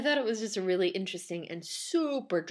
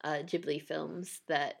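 normal talking voice

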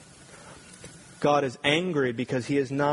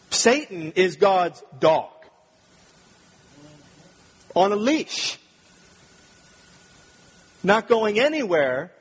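raised, louder preaching voice; full-force preaching; 5 semitones higher, 4 dB louder than usual. Acted. Preacher proclaiming God's holiness, raised and fervent. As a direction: preach it out with conviction to the room.

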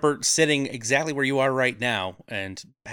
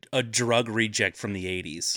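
ordinary talk, nothing marked

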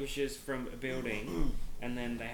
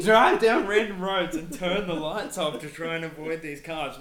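laughing; 5 semitones higher, 11 dB louder than usual. Real, not acted. Laughing continuation of story.

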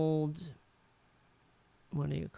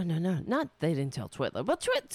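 ordinary talk

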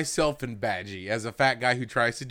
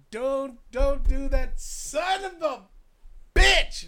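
slow, much higher, rising to a loud angry curse; anguished curse; 12 semitones higher, 3 dB louder, much slower than usual. Retold or imitated. Fan reliving a favorite character's death, anguished curse. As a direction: drag it out in disbelief, then snap. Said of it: "I'm like".

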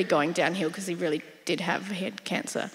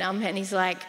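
usual speaking voice

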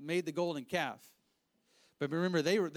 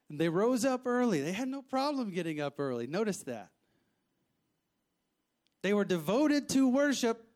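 higher, louder preaching voice; full preaching; 4 semitones higher, 4 dB louder than usual. Acted. Preacher stressing the people's devotion, forceful preaching. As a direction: drive the point home to the congregation.

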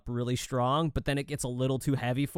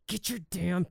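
muttered under breath; 4 semitones higher, 5 dB quieter than usual. Retold or imitated. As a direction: say it under your breath so the kid won't hear. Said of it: "under her breath".

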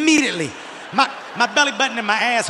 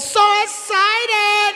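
high, slow, drawn-out squeal of "So excited!"; mock cheerful ride operator, high and slow; imitating the ride attendant; 11 semitones higher, much slower than usual. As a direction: stretch every syllable up high like an overjoyed attendant. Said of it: "They're like".

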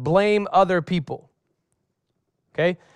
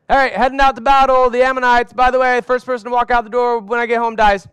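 louder, higher, brisk call to the household; mock battle announcement; 6 semitones higher, 6 dB louder, faster than usual. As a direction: announce it cheerfully as you head out the door. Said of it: "maybe tell the people in your house".

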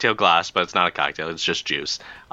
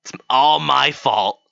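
sarcastic; 3 semitones higher, 3 dB louder than usual. Acted. Bartender gives in sarcastically.

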